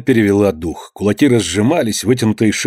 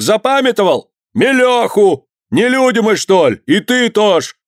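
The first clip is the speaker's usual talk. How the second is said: high, furious accusations; sustained high-pitched mob cries; angry crowd shouting; 9 semitones higher than usual. A narrator's reading. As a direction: rouse the mob against them. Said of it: "закричали в толпе".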